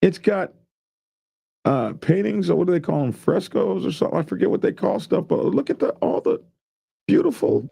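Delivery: high, eager gushing over art; excited. Real, not acted. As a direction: marvel at every detail. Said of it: "He's, he's excited about the painting".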